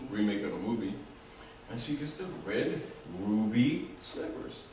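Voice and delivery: normal talking voice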